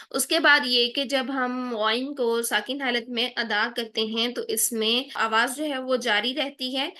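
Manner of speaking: ordinary talk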